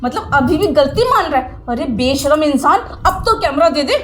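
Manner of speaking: heated, emphatic telling-off; indignant outburst with punched words; angry scolding. Acted. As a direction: scold him hard and threaten him. Furious teacher confronts student, threatens police.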